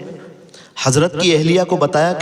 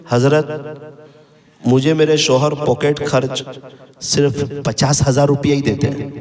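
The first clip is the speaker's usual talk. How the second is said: ordinary talk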